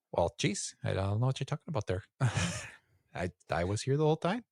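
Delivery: Minnesota nice, folksy and high; aw-shucks Fargo twang; Midwest accent. Retold or imitated. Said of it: "Midwest guy".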